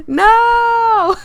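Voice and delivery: long, high, loud "no"; drawn-out cry of playful horror; mock-horrified wail. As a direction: wail it in hammed-up dismay. Mock horror at being followed home.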